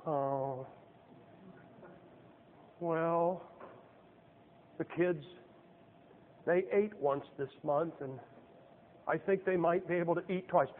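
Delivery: soft, slow, woeful mock complaint; mock poor-mouthing. Preacher mocking a Baptist asked how he's doing, soft and woeful. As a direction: sigh it out like a pitiful pauper.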